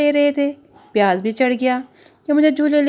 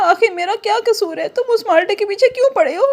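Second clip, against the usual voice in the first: higher, fast indignant onion; 7 semitones higher than usual. Acted. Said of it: "प्याज ने कहा".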